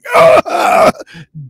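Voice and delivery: loud, high strangled groan; exaggerated death-throes noise; mock dying groan. Acts out literally dying.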